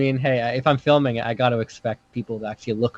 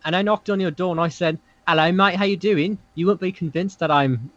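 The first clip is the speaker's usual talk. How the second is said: higher-pitched, badly faked Aussie accent; faked Australian-British accent; 5 semitones higher than usual. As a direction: play a visitor in a wobbly Australian accent that drifts British. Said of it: "oh, that's British".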